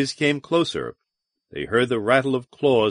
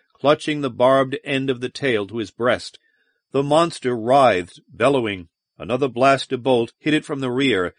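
ordinary delivery

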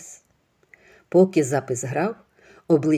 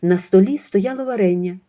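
ordinary delivery, nothing marked

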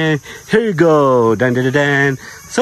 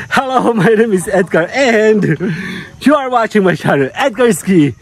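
bright, slow host intro, higher; upbeat channel intro; 4 semitones higher than usual. Acted. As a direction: announce yourself like a show host. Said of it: "Mag-intro muna ako".

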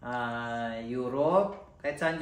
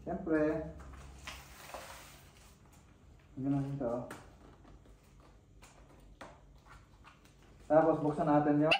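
muffled behind closed visor; through a welding helmet; 3 semitones higher than usual. Real, not acted. Seen 0: Helmet with air hose worn over face throughout; references bare-faced.

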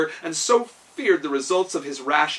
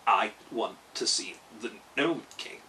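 low, jerky clockwork voice; a lightly voiced clockwork man, stiff and jerky; 6 semitones lower, 5 dB quieter than usual. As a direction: speak like a wind-up machine, in lightly jerky bursts. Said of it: "in his jerky voice".

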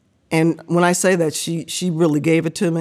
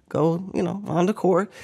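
ordinary delivery

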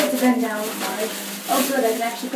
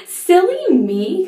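silly me; 8 semitones higher, 7 dB louder, much slower than usual. Real, not acted. Scolds herself after camera mistake.

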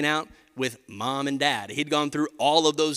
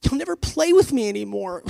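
imitating sad little son; 4 semitones higher than usual. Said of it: "he said".

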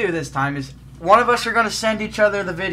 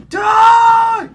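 high, loud battle cry; yell; 14 semitones higher, 11 dB louder than usual. Real, not acted. Gamer yelling at the screen mid-level. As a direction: bellow it at the screen.

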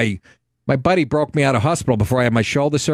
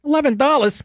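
high, squeaky disbelief on what; shocked at the price; 8 semitones higher than usual. Retold or imitated. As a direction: shoot up in pitch, stunned by the price. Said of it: "I'm like".

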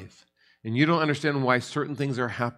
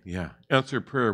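ordinary talk

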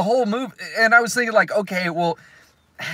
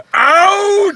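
mock shout; 13 semitones higher, 5 dB louder than usual. Retold firing scene.

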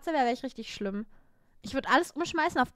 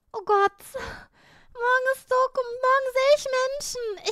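high, loud anxious wail; nervous panic; 11 semitones higher, 5 dB louder than usual. Real, not acted. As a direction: wail in dread of tomorrow. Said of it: "ich bin so nervös". (hohes, nervöses Jammern)

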